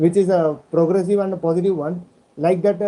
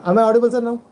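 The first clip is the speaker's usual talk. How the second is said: raised voice so he can be heard; speaking up loudly; 5 semitones higher, much faster than usual. Real, not acted. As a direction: project it for a bad line. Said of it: "You have to speak loudly and come closer".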